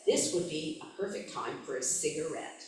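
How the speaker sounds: steady read-aloud narrator voice; story narration. A narrator's reading. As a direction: read the novel passage to the listeners. Reads novel aloud.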